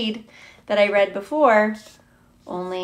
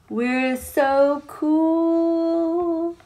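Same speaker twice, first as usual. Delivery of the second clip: smug cool drawl; 7 semitones higher, much slower than usual. Pretend. Cool beans boasting.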